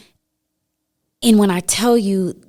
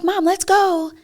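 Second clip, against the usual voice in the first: put-on light, much higher kid voice; imitating her son; 13 semitones higher, slower than usual. Mother quoting her young son at the counter, higher kid voice. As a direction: play the impatient seven-year-old, lightly. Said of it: "He's like".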